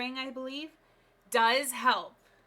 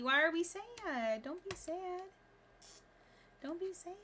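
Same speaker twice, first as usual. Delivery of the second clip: cooing consolation; 8 semitones higher than usual. Real, not acted. Consoling Petey in baby talk.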